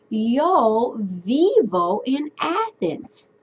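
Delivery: slow, clear model sentence; slow demo. Shown as a demonstration. Teacher modeling Spanish for students, slow and clear. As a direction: say it slowly for learners. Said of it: "I would say".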